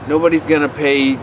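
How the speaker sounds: ordinary talk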